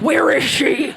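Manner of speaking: mock jealous interrogation shout; hammed-up superhero growl; Batman. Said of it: "turn into Batman?".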